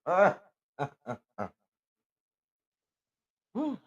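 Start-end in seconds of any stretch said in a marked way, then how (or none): 0.8-1.5 shaky breaths. Real, not acted. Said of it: "I'm together".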